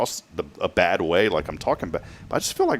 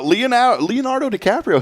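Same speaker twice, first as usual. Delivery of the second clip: much higher and louder, the name sung out; excited name call; 5 dB louder than usual. Real, not acted. Host teasing celebrity news to co-host, excited name call. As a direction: announce the star like a big reveal.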